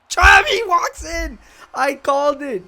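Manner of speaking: screaming high and loud at the winning goal; real ecstatic scream. Real, not acted. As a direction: shriek with joy as he scores.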